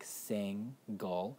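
"sing, gull" as two separate syllables; split into syllables. Shown as a demonstration. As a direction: break single apart: "sing", pause, "gull". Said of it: "in the second syllable".

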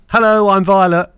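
put-on high, loud little-girl greeting. Dad imitating his sociable daughter greeting strangers, high little-girl voice. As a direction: imitate a bold child introducing herself. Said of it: "So my little girl".

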